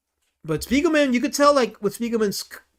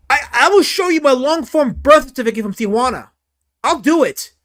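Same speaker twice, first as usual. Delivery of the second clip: loud, high, hammed-up protest; comic flustered outburst; mock indignation; 7 semitones higher, 6 dB louder than usual. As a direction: insist loudly as if wrongly accused. Mock protest, offering proof.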